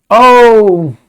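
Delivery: high, loud burst of recognition; sudden loud oh; excited oh. Real, not acted. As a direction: blurt it out, delighted. Exclaims recalling friends.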